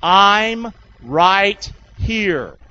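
stretched out, each word drawn long; slow unison call; very slow recitation. Shown as a demonstration. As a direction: lead them slowly through it. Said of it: "Say it with me".

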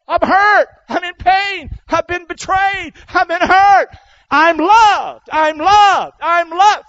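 high, loud cry of I'm loved; loud self-declaration. Shown as a demonstration. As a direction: shout it to yourself with conviction. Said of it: "You need to tell yourself that".

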